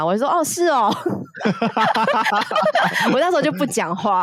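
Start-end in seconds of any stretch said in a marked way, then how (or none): 0.3-0.9 high, mock-surprised oh really; feigned innocence. 0.9-3.1 rising giggle.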